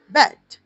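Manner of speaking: the sounds ब, ए, ड merged into बेड, said loud and high; blended word. Shown as a demonstration. Teacher blending sounds for students, loud and clear. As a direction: land बेड firmly as one word. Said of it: "इसमें एक sound आप डाल दें".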